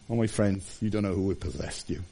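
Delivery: soft, low, grave warning; hushed warning. Real, not acted. Preacher warning his friends softly, hushed and grave. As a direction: lean in and warn them quietly.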